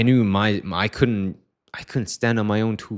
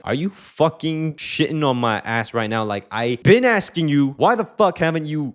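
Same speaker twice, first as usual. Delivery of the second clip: louder, higher, slow exasperated snap; mock the friend losing patience; retold annoyed outburst, louder and higher; imitating angry friend; 8 semitones higher, 4 dB louder than usual. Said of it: "he's, like, tilted".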